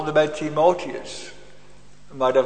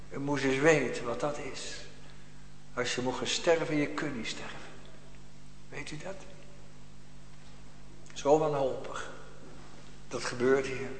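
hushed solemn; 9 dB quieter than usual. Acted. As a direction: drop to a hushed confiding tone. (zacht en ernstig)

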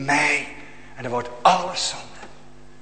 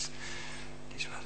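barely audible, slow whisper; hushed awe; 18 dB quieter, much slower than usual. Real, not acted. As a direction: let it fall away in dread. Awe at damnation.

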